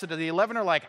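ordinary talk

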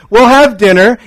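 normal talking voice

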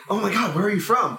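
excited, mock-astonished questioning; amazed question. Classmates asking about the British accent, amazed.

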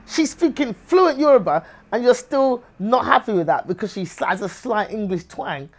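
high, loud indignation, easing towards the end; worked-up comic exasperation; exasperated protest. Retold or imitated. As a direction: act out throwing up a hopeless complaint. Exasperated reply to the critics.

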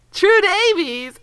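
calling out a name. Shown as a demonstration. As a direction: call "True Davies!" across a hall. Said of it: "they call True Davies".

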